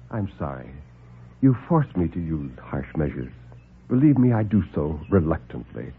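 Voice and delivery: low, soft, smooth doctor; calm the men down, unhurried and superior; suave doctor. Acted. Doctor soothes the alarmed lieutenant, low and suave.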